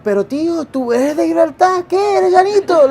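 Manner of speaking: retold excited outburst, loud and high; really excited. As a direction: blurt it out in thrilled Spanish, re-enacting the moment. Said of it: "I was really excited".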